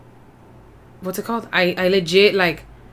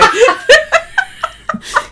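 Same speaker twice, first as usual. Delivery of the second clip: squealing burst of laughter; high shrieking laugh; 9 dB louder than usual. Real, not acted. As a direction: let out a shrill cackle. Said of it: "laughing at friend's prayer".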